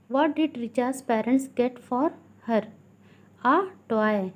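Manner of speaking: slow, deliberate classroom reading; teacher slowly dictating from a textbook; textbook read-aloud. Acted. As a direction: read it out word by word for pupils. Reads textbook questions aloud.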